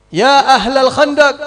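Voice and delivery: loud, high, slow call to the whole trench. Acted. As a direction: call out to everyone far away. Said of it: "gitu Rasulullah teriak". (berseru keras memanggil semua sahabat)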